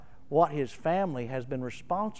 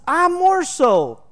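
boasting; 11 semitones higher, 8 dB louder, slower than usual. Pretend. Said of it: "He's going, says".

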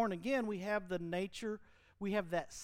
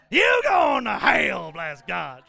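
slow, much louder, hammed-up shout; mock hellfire preacher; 15 dB louder, much slower than usual. Preacher parodying hellfire sermons, slow mock shout. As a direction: bellow it like a fire-and-brimstone preacher. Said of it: "I've been in them, sir".

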